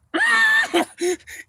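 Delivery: very high, loud acted scream; comic scream. Scalded man under the creek, comic scream. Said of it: "he started screaming like".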